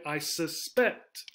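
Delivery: slow "I sus-PECT", weight on PECT; second-syllable stress. Shown as a demonstration. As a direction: land on PECT in suspect. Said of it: "we stress the second syllable".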